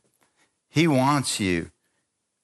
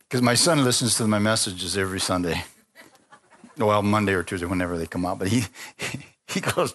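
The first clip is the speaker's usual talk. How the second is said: normal talking voice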